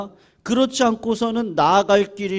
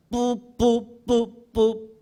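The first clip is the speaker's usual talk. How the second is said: short choppy horn blasts; staccato; 3 semitones higher, much slower than usual. Shown as a demonstration. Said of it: "스타카토로 딱딱 끊어가지고".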